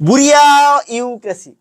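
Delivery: বুড়িয়া held high, pause, then ইউক্রেসি; বুড়িয়া split off and drawn out; broken-up reading. Shown as a demonstration. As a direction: stretch "buriaa" before dropping to "yu-kresi". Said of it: "এটা ভাঙাইয়া কেমনে পড়ি".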